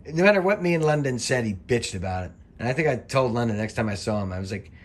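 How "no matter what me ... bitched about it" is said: ordinary delivery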